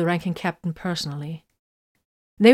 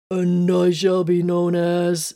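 slow, lightly mechanical machine spirit; a hint of an echoing ship mind; much slower than usual. Ship's machine spirit announces its new name, slow and mechanical. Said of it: "a mechanical voice echoed".

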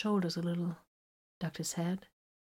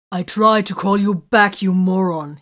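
loud furious yell, temper gone; angry outburst; 10 dB louder than usual. A narrator's reading. Titus yelling at Vox for ignoring his call, loud angry outburst. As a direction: let the temper blow, shout it. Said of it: "he burst out".

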